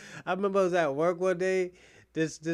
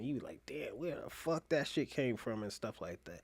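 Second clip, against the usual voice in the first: hushed aside; 4 semitones lower, 9 dB quieter than usual. Acted. Lowered voice on intimate talk.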